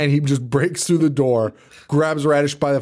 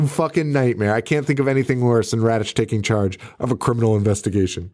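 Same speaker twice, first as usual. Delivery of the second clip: normal talking voice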